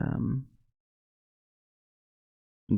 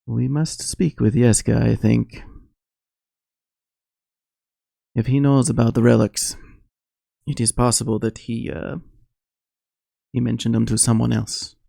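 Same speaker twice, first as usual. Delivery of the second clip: put-on light Barovian accent, slightly raised pitch; a hint of a Barovian accent, measured and grave. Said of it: "Astrid kinda delved into a, uh, Barovian accent there".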